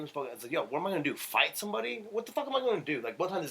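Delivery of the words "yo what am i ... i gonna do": exasperated disbelief. Real, not acted. Exasperated at being told to fight.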